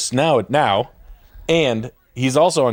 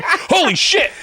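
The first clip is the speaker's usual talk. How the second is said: higher laughing "Holy shit"; mock shock; slower than usual. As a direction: react in disbelief while laughing. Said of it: "It was like".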